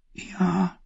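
breathy high whispered yes. A narrator's reading. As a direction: whisper it shyly. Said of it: "wisperte Cedra". (geflüstert)

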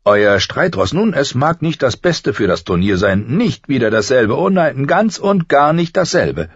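the stableman lectures about warhorses, rambling; garrulous old stableman. A narrator's reading. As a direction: go on and on about horses. Hallen talks about horses.

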